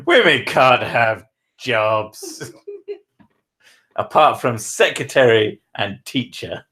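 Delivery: slow, laughing mock chauvinist. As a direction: parody a sexist with a laugh, slowly. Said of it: "not an opinion I actually hold".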